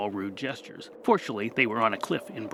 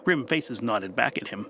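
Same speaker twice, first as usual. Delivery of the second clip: ordinary delivery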